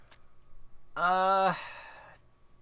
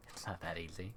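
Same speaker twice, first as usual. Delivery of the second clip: quiet; 10 semitones lower, 16 dB quieter than usual. Acted. Game line read under breath.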